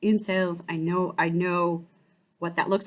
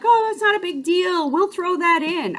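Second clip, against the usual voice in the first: higher, louder breezy salesman; eager salesman voice; 8 semitones higher, 3 dB louder than usual. Retold or imitated. Car salesman waving off her excuse, higher and breezy. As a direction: brush off the worry like a keen dealer. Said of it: "he was like".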